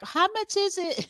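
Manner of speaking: high-pitched caller asking the price; mock caller. Client phoning the photographer, a high mock caller voice.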